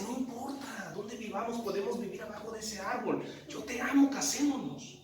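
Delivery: a lightly voiced infatuated young woman; lovestruck girlfriend. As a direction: sweetly coax him to marry. Smitten girlfriend voiced in story.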